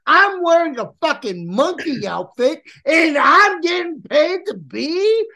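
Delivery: high, wailing imitation of a grumbling performer; exaggerated high-pitched griping; mock complaint. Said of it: "He was just like".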